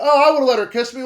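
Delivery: high, loud, quick mock voice; high-pitched, hurried dismissive impression; mocking other people. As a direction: imitate breezy people in a rush. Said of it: "Some people are like".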